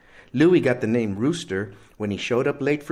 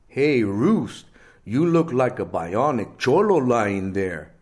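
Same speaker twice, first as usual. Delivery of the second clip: nervous; 5 dB louder than usual. A narrator's reading. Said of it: "says Ray nervously".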